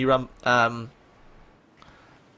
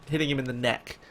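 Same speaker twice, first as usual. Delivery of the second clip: usual speaking voice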